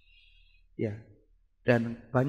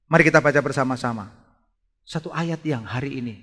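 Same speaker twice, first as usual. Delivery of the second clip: normal talking voice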